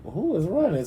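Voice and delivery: confused. Retold or imitated. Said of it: "So I'm like".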